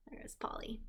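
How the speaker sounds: whispered aside. Real, not acted. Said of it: "aside to pet".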